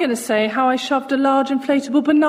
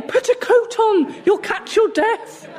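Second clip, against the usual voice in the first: nagging mother; 9 semitones higher, slower than usual. Retold or imitated. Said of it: "I kept saying".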